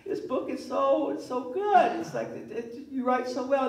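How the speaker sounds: high, breathy-free gushing mother voice, pitched well up; imitating mom's high gushing praise. Son imitates his mother praising his book in a high gushing voice.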